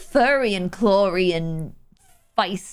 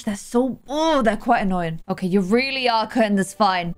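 ordinary delivery

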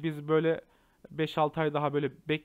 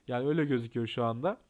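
ordinary delivery

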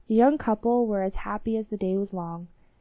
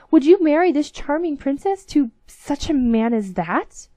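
a touch of indignation, higher and louder; indignant captain; 6 semitones higher, 8 dB louder than usual. Acted. Captain challenges the king over the match, mild indignation. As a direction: challenge the king, mildly outraged. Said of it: "cried the young captain".

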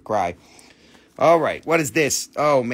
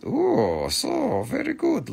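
cooing delight; 9 semitones higher, slower than usual. Acted. Cooing over a gem.

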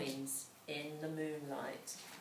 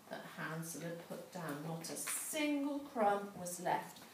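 plain reading voice

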